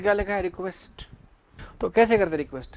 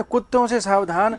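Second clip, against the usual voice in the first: कुत्तों slowed and louder with a rising tune; stress on कुत्तों; 2 semitones higher, 5 dB louder than usual. Shown as a demonstration. Teacher joking to the class about the plural dogs sign. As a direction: lean on कुत्तों, slowly and pointedly. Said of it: "ऐसा लग रहा है".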